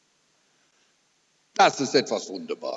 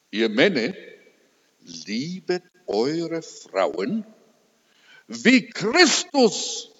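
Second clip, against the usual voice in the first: slow, solemn preaching of scripture; preacher reciting Ephesians five, solemn and swelling; scripture recitation; 6 semitones higher, 5 dB louder than usual. Acted. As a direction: proclaim it with rising fervour.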